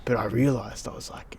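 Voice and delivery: ordinary talk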